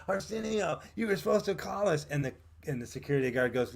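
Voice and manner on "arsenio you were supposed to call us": higher pitched call across a fence. Retold or imitated. To Arsenio across the fence, a higher calling-out reproach. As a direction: call out to him over the noise.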